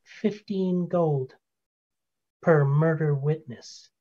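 very slow, deliberate delivery; clear as day. Acted. Halfling exposing a witness to his boss, slow and clear. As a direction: draw each word out distinctly. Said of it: "you hear him clear as day".

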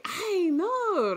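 high, drawn-out Spanish "Ay no"; Spanish exclamation. Shown as a demonstration. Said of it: "And in Spanish, you might say".

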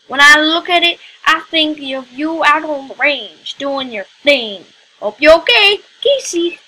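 sticking with a put-on Texan accent. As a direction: keep up the Texan accent to the end of the letter. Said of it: "I'll stick with it".

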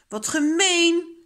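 high, loud, drawn-out indignation; angry protest. A narrator's reading. Child cross about the dessert rule, angry protest. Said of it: "zegt Kolletje boos".

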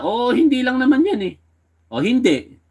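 mock her defensive dismissal; mocking the accused. Commentator mimicking the accused's evasive answer, high and dismissive. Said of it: "Natanong lang siya".

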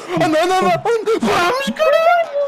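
high excited yelling; raging gamer. Real, not acted. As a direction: roar at the game.

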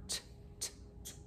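whispered t, t, t, tapped out separately; teacher isolating the t sound; isolated t sound. Shown as a demonstration. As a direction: spit out a tiny unvoiced t three times. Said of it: "That's a T".

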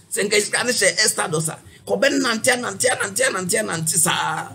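high, loud mock sermon; imitating a prophet. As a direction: play the fiery prophet preaching. Mimics prophet's sermon in Twi.

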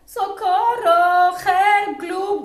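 very loud, high, drawn-out yells; shouting for help. Acted. Drowning shadow who cannot swim, shouting for help. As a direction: holler for rescue, stretching the syllables. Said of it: "con mucho chapoteo y gritos".

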